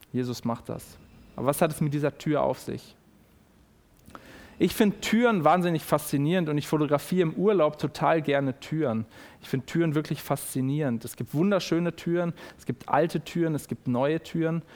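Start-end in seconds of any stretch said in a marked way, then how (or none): none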